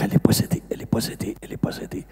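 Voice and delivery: urgent whispered repetition, dying away; hushed warning. Retold or imitated. Helpers warning the priest about a woman, hushed whisper. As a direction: imitate helpers whispering in alarm.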